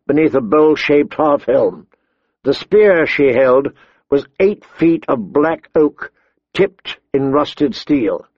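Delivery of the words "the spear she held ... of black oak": ordinary delivery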